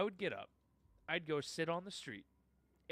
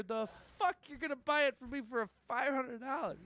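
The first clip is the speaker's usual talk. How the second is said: mock outraged rant; 8 semitones higher, 4 dB louder than usual. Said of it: "I was like".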